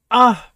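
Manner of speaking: short, high, loud yell; sudden acted cry of fright; alarmed scream. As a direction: yelp in alarm. Acting character in distress.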